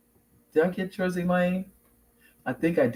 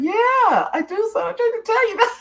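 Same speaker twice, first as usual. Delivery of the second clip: mock exasperation. Mom retelling how she finally convinced her son, exasperated. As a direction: squeal the reply in playful protest.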